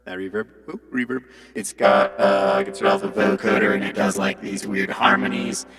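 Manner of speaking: robotic vocoder-processed voice. Shown as a demonstration. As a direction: speak through the vocoder. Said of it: "This is my vocoder".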